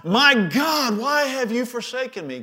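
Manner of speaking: Jesus crying out from the cross; agonized cry. Acted. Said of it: "he cried out from the cross".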